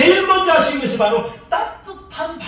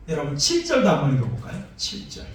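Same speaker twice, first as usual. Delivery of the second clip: ordinary delivery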